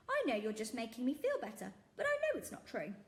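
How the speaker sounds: high, loud gentle disbelief; kindly shopkeeper. Acted. Shopkeeper refusing the girl's frog claim, high and loud. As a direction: brush it off warmly.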